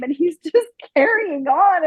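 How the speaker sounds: normal talking voice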